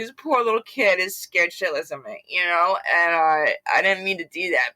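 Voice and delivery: usual speaking voice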